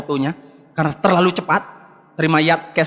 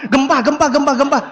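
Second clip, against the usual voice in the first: mock alarm; 6 semitones higher than usual. Husband pranking his sleepy wife, mock alarmed with a laugh. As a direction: shout earthquake as a playful prank.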